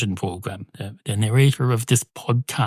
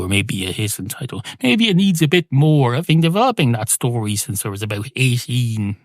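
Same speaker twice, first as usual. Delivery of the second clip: ordinary talk, nothing marked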